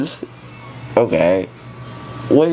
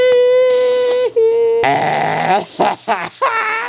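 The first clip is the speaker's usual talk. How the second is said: high loopy giggle; drugged giddy laughter. Real, not acted.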